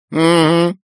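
high squawky pelican voice; old pelican voice. Acted. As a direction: squawk it like a grumpy old bird. Pelican grunts.

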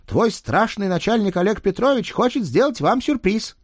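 put-on high, loud eager kid; excited child. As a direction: announce it bursting with news. Boy reports excitedly.

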